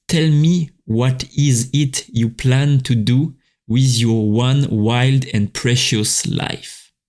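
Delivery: slow, deliberate English quote in a French accent. Real, not acted. French podcaster reading a poet's quote to listeners, French-accented English. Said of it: "ils vont critiquer mon accent anglais".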